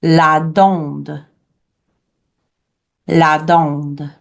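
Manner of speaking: slow, measured "la dinde"; French pronunciation. Shown as a demonstration. Teacher gives class the word for turkey, French pronunciation. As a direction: model "la dinde" in French for repeating. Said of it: "turkey in French is".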